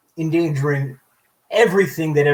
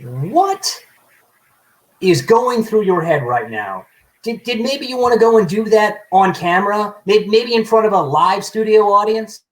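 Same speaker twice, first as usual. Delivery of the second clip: sarcastic; 7 semitones higher, 4 dB louder than usual. Acted. Sarcastic jab at the other character.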